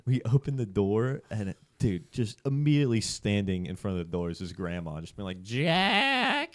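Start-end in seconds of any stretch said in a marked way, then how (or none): 5.4-6.5 high, loud, stretched-out name; grandma's scolding call. Retold or imitated. Grandma catching grandson at the door, high mock yell. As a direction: imitate a grandma yelling for her grandson.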